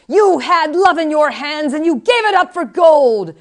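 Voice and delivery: slow, high, very loud denunciation; yelling. A narrator's reading. Old woman accuses Buttercup of giving up love, yelling. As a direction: yell it out, drawing the words out. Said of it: "suddenly she was yelling".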